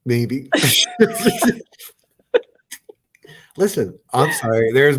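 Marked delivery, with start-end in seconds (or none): 0.5-1.6 high squealing laugh; big burst of laughter. Real, not acted. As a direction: crack up loudly. Teasing about calling her clown.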